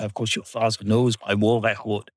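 lightly imitated Swiss-accented teacher; a touch of a Swiss accent, smoochy; mock-confiding in a Swiss accent. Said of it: "he'd refer to my dad and say".

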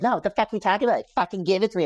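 fast, high mock yell of a drunk woman; hammed-up drunk demand, rushed and high; mock angry drunk woman. Drunk woman yelling at him to stay. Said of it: "She's like".

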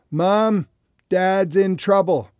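loud, high alarmed call for help; urgent call. Retold or imitated. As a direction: call out to Mom in a panic. Daughter calls out alarmed.

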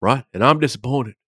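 a hint of a disappointed Saban; mild Saban imitation at the press conference; Saban impression. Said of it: "in my best Joey Molnaro voice".

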